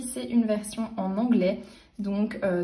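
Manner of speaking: ordinary talk, nothing marked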